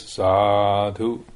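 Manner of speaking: low, drawn-out mock chant of Sadhu; lay people's Sadhu. Monk imitating lay people answering a blessing, low drawn-out chant. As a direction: imitate the lay crowd's pleased response. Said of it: "Every single one says".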